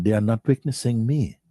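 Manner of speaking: ordinary talk, nothing marked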